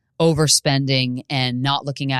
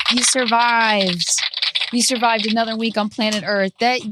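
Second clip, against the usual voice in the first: mock cheer; 7 semitones higher than usual. Mock celebration to audience.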